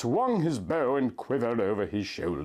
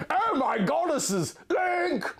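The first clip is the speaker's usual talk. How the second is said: crying out; 13 semitones higher, slower than usual. Acted. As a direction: cry out in shock at her bound love. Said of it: "I cried".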